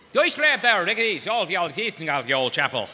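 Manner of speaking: high, loud garbled Irish brogue; thick Irish priest again. Retold or imitated. Comedian repeating the priest's mumbled Irish words. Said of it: "it sounded like he said this".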